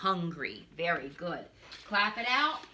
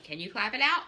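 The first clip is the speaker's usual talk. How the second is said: normal talking voice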